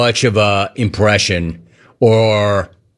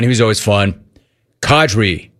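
ordinary talk, nothing marked

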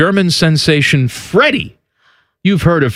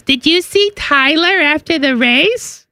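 high daughter voice; 11 semitones higher, slower than usual. Retold or imitated. Daughter quoted in story.

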